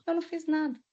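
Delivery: a softly voiced, smug denial after a laugh; lightly mimicked cynical shrug of a line. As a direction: imitate him brushing it off with contempt. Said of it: "o jeito mais cínico, diabólico".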